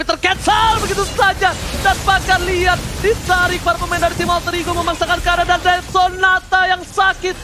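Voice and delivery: hyped live play-by-play call; fighting game announcer. Acted. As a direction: call the teamfight like a hype caster. (komentator esports yang bersemangat)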